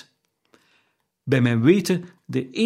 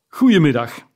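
curt dismissal; 2 semitones higher, 6 dB louder than usual. A narrator's reading. Scrooge dismisses his nephew.

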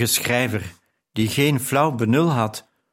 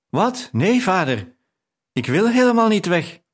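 higher and louder, startled refusal; shocked protest; 8 semitones higher, 3 dB louder than usual. Acted. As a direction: burst out in disbelief, then refuse firmly. Daughter protests leaving with father.

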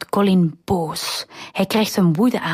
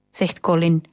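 usual speaking voice

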